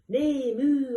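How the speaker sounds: 2 high, loud neigh and moo; 3 blast out the farmyard noise; 1 loud animal calls. Acted. 4 animals scaring the thieves together, loud calls.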